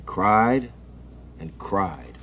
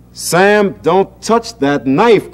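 higher, louder, slow warning cry; mother crying out; 8 semitones higher, 6 dB louder, much slower than usual. A narrator's reading. Mother warns Sam off the knife, raised cry. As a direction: stop him grabbing the knife. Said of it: "cried his mother".

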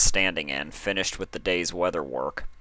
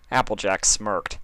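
usual speaking voice

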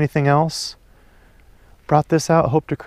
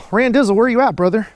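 calling out; 8 semitones higher than usual. Real, not acted. Calling to absent friend.

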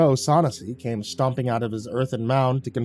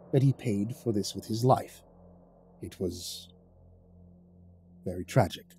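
said quietly; 3 semitones lower, 4 dB quieter than usual. Acted. Casimir reassuring the newcomer, low and quiet. Kaz answering, no tag.

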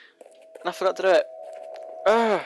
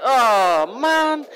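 loud, rising "oh, man!" jumping high; excited outburst; 5 semitones higher, 6 dB louder than usual. Real, not acted. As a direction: blurt it as you barely survive. Narrow escape in boss fight.

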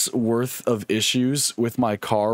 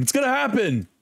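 higher, hyped promise to chat; 9 semitones higher than usual. Real, not acted. Streamer hyping chat before a match, excited. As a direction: sell the coming win to viewers. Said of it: "So you're about to witness a win".

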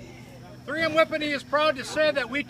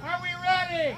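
shouted hype-up; 4 semitones higher than usual. Real, not acted. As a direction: yell it to the crowd. Hyping up crowd again, shouting.

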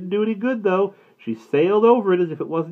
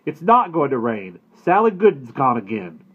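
louder, fed-up father; disgusted father; 4 semitones higher, 7 dB louder than usual. A narrator's reading. Father tells mother the cow escaped, disgusted and louder. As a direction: grumble out the bad news. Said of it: "Papa said in a disgusted voice".

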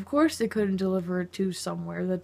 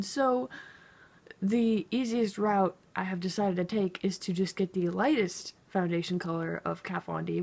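ordinary delivery

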